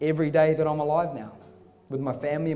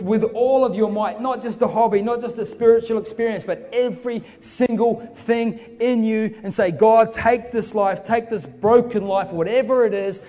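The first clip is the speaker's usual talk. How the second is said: raised, driving appeal, hammering key words; preaching at full force; 6 semitones higher, 4 dB louder than usual. Real, not acted. Preacher urges the crowd to give God everything, full-force preaching. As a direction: build an impassioned altar call without letting up.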